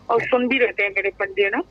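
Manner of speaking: usual speaking voice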